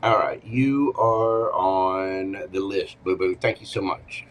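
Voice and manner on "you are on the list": ordinary talk, nothing marked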